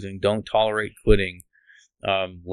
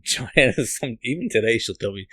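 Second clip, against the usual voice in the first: ordinary delivery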